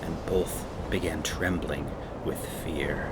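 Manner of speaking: gentle read-aloud storybook voice; lively children's storyteller; story narration. Acted. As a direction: read it like a bedtime story to kids.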